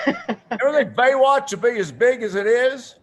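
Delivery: high, loud impersonation of a politician; President Clinton. Retold or imitated. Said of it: "he goes".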